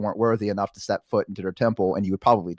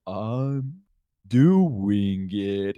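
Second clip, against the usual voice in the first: lightly voiced turtle; 5 semitones lower, much slower than usual. Turtle slowly pulling them up.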